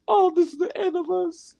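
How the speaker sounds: very high, panicked falsetto wail; panicked Carlton. Retold or imitated. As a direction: squeal it in high falsetto like it's over. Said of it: "Carlton was like".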